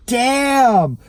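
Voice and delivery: mock-impressed exclamation; impressed damn. Driver amazed by phone trick.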